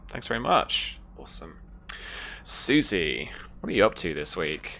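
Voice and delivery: ordinary talk, nothing marked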